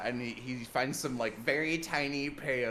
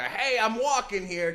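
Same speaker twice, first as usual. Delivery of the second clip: higher, louder mock street tough; New York street accent; 8 semitones higher, 5 dB louder than usual. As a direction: bark it like a movie extra cabbie. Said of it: "He has the accent of".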